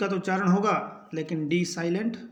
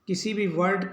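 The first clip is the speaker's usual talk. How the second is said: ordinary talk, nothing marked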